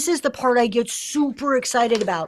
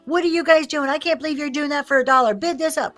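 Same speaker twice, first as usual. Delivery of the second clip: higher and louder, hammering the viewers to bid; pushy sales pressure; 4 semitones higher, 4 dB louder than usual. Shown as a demonstration. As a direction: push them hard like a hype auctioneer. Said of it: "what does pressure sound like?".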